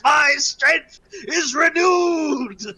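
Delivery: high, slow, loud victory cry; triumphant Klargard. Acted. Klargard puts a foot on the table, triumphant cry. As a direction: bellow it out, stretching each word. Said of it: "he goes".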